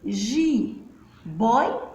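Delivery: "Jiboia" split into ji, boi, a with long pauses; syllable by syllable. Shown as a demonstration. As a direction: sound out "Jiboia" in separate syllables. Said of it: "Agora vamos separar a sílaba". (sílaba por sílaba, com pausas)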